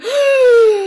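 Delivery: loud gasp breaking into laughter; shocked gasp. Real, not acted. Shocked reaction to friend.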